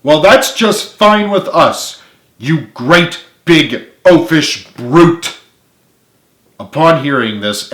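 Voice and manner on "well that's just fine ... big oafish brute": loud, high, slow insulting yell; angry yell. Acted. Carly yelling back at James as he storms off, angry. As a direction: spit the insult at him. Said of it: "she yelled".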